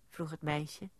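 plain reading voice

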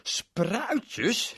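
high, loud disgusted cry; angry outburst. Acted. Berend shouts at his mother, high and loud. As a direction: explode at the word. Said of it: "En hij werd ontzettend boos".

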